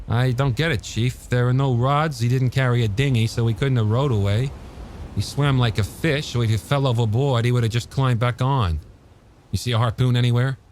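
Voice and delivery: a hint of a puzzled young deputy; lightly voiced young deputy. A narrator's reading. Hendricks voiced by narrator.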